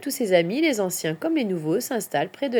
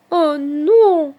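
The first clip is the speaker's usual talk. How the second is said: high, loud cry of dismay; 7 semitones higher, 9 dB louder than usual. A narrator's reading. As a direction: lament as a little hedgehog. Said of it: "se désole Petit Hérisson". (désolé, voix aiguë et forte)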